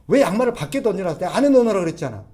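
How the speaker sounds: wife snapping. Retold or imitated. Wife snapping at her husband over socks left out, loud and fast. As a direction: fire off the complaint at once. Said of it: "아내가 대뜸".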